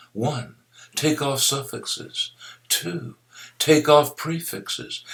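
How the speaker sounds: teacher laying out steps one by one; slow, spelled-out steps. Shown as a demonstration. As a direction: count the steps out for students. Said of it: "teaching the steps".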